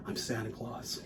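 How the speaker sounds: low, very soft mock superhero reveal; hushed, lightly gravelled Batman-style voice; semi-gravelly voice. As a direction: imitate a grave confession, deep and hushed. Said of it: "like, a semi-gravelly voice".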